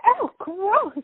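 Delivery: high-pitched mock scream of disgust; retold scream. Recalling kicking paper off her shoe, mock screaming. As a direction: squeal it in mock disgust, not full volume. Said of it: "I screamed and went".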